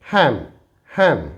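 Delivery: हैम said as ham; silent n demo. Shown as a demonstration. Teacher showing students how to say हैम. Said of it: "इसे हम पढ़ते हैं".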